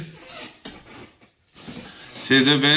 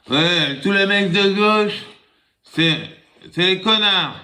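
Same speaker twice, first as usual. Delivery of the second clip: put-on drunk rant; 8 semitones higher than usual. Drunk man ranting in bar.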